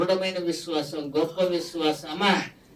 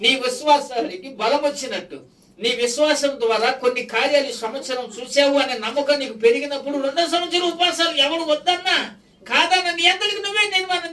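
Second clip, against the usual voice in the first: raised, loud, driving sermon voice; preaching at full force; 6 semitones higher, 5 dB louder than usual. Acted. Pastor urging his congregation to fast with faith, preaching at full force. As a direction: push it out to the whole church, climbing.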